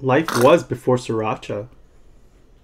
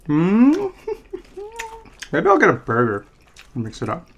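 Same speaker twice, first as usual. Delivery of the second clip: talking between bites; chewing fries; 9 semitones higher than usual. Real, not acted. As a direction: mouth partly full. Seen 1: Fries eaten at -2.0; cheeks puffed, lips pressed while laughing.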